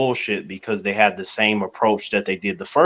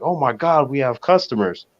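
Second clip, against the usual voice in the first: pitched-up mock delight, a touch of excitement; happy concession workers; 4 semitones higher than usual. Cinema staff seeing customers in a ghost town, mildly excited. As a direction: lightly imitate pleasantly surprised staff. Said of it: "everybody was happy that was working".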